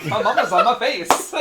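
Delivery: giggling repeat of the line; laughing. Real, not acted. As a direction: say it through laughter.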